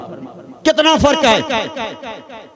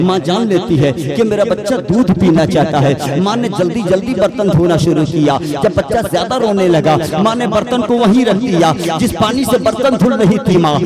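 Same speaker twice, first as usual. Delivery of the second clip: storytelling that swells a little louder and higher; rising sermon narration; 4 semitones higher, 4 dB louder than usual. Acted. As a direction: build the story toward the mother's words.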